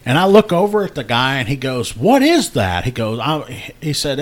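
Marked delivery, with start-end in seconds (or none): 2.0-2.9 baffled question. Retold or imitated. Baffled brother asks about doll.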